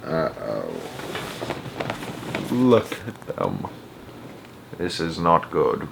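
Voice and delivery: low, soft, heavy-hearted muttering; downcast. A narrator's reading. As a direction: mutter it gloomily to yourself. Wonka despairs over the babies.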